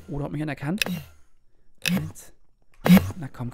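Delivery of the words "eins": usual speaking voice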